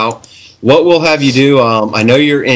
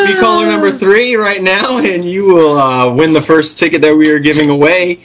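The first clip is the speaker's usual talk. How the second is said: laughing; 7 semitones higher than usual. Real, not acted. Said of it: "I about fell off my chair".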